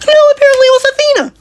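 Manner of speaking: very high, fast indignant squeak; outraged squeal. Real, not acted. Ranting to viewers about the twist: indignant high squeal. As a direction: blurt it out in disbelief.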